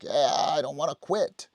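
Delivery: high, keen dog voice; a dog begging to keep going; lightly voiced eager dog. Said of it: "he'd be like".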